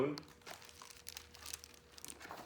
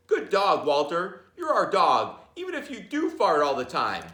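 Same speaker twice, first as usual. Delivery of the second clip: louder, a touch higher; praising the dog with a lift; fond praise; 5 semitones higher, 6 dB louder than usual. Acted. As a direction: cheer the dog on.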